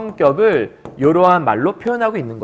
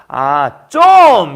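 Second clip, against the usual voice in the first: Gyeongsang dialect; 8 dB louder, much slower than usual. Retold or imitated. Teacher showing off Gyeongsang dialect, loud exclamation. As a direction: bark it out the Gyeongsang way. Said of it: "경상도 사투리".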